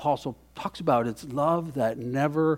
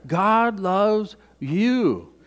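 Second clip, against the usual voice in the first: slow emphatic; 6 semitones higher, much slower than usual. Shown as a demonstration. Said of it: "is just to emphasize this".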